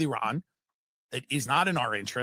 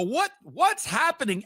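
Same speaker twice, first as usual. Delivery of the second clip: mock confusion; 10 semitones higher than usual. Acted panicked neighbor scene.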